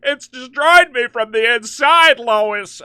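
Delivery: loud, high melodramatic cry; mock despair at full volume; hammed-up anguished Superman. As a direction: wail like a ruined hero. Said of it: "we're ad-libbing. We're improvising".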